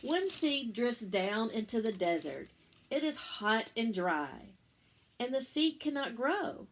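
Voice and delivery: gentle picture-book read-aloud; reading to children. A narrator's reading. Parent reading Eric Carle to Lucas, gentle storytelling.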